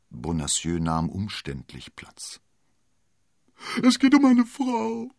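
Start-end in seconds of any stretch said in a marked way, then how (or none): 3.8-5.1 high, loud wailing landlord; hammed-up weepy husband; whiny, tearful. A narrator's reading. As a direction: whine it out in a high voice. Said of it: "sagte er dann mit weinerlicher Stimme".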